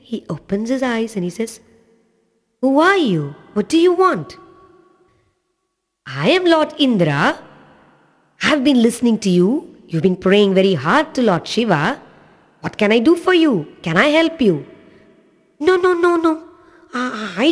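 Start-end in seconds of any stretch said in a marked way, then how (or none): 2.6-4.4 put-on little boy voice. Boy wakes, questions stranger. 6.1-14.7 a friendly god, a little higher and quick at first; lightly voiced Lord Indra. Lord Indra offering help to the praying boy, friendly.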